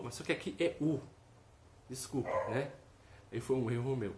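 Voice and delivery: ordinary talk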